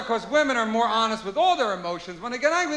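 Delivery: ordinary talk, nothing marked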